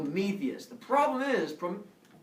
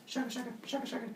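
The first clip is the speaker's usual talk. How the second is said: 1 stick rubbing noise; 10 semitones higher, 6 dB quieter, faster than usual. Retold or imitated.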